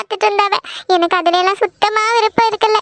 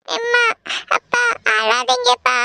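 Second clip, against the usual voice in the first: high, slow daughter's plea; tearful, slow consoling; tearful pleading; 4 semitones higher, 3 dB quieter, slower than usual. Acted. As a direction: beg your parents through tears, drawn out. Tearful daughter consoles crying parents.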